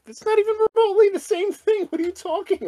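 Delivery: squeaky disbelieving voice; shrill mock outrage at the comparison; high incredulous. Real, not acted.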